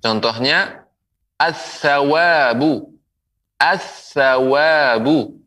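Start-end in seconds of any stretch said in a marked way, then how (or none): 1.4-5.4 slow "as-sawabu", l merged into a held tha; merged article. Shown as a demonstration. Quran teacher models the letter tha, merged article. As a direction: stretch the doubled consonant and long vowel in "as-sawabu". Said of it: "alif lam melebur ke huruf tha".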